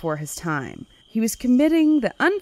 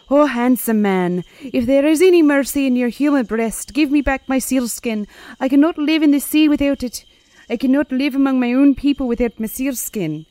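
begging selkie wife; 6 semitones higher, 5 dB louder than usual. Acted. The selkie begs the goodman for her skin, high loud pleading. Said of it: "She begged".

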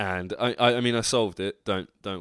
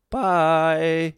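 sing-song bye; 7 semitones higher, 6 dB louder than usual. Real, not acted. Sign-off.

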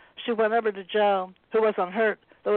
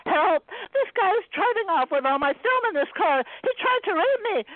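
screaming for help; 10 semitones higher than usual. A narrator's reading.

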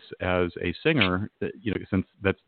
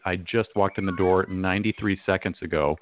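normal talking voice

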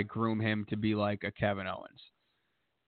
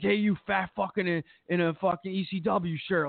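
higher, sneering heel taunt at a fan; mocking heckle; 7 semitones higher than usual. Wrestler heckling a fan at a convention, higher sneering taunt. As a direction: jeer at him like a cocky wrestling heel. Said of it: "M J F was like".

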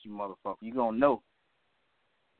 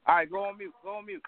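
impatient order; 10 semitones higher than usual. Real, not acted. Ordering caller to mute.

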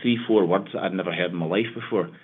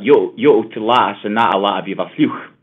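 "Yo, to lata, sanata, lata" said with heavy glottal stops and a loud throaty close on "fluch"; loud, glottal West Highland dialect, quoting an old man; old Argyll man's Gaelic-accented Scots; 2 semitones higher, 8 dB louder than usual. Retold or imitated. Quoting an old gardener grumbling about wet weather, in a loud glottal Argyll dialect. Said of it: "You can hear this glottalization".

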